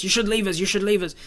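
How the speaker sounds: loud, high angry shouting at the guards; angry crowd shouting. Acted. Crowd member yelling at guards, angry shout. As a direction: shout it up at the gate like an angry mob.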